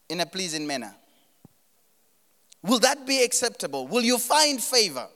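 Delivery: high, loud preaching as God's voice. Acted. Preacher voicing God reasoning with the church, pressing.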